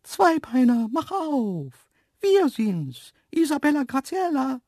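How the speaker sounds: very high, loud, singsong animal voice; antelope calling out. Acted. Antelopes calling at the terrace door, high-pitched hail. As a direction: call from outside in a high cartoon voice.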